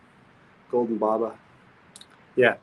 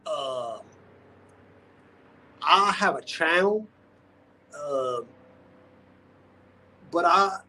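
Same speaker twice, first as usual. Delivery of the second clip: a lightly raised voice playing Ross; Ross impression; 5 semitones higher than usual. Streamer voicing Ross's self-introduction to viewers, mildly higher. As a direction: read the message as Ross introducing himself, a bit higher. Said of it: "do some of the imitation".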